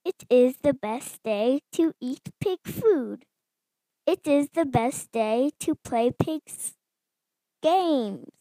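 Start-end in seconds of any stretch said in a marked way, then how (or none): none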